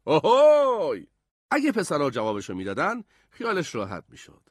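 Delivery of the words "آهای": high, loud, long holler; calling out. A narrator's reading. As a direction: holler the signal to find each other.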